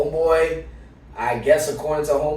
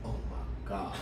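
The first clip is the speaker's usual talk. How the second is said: mock stressed whisper; 15 dB quieter than usual. Stressed guy reacting, mocked.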